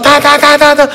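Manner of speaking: mimicked beat. Imitating the music beat.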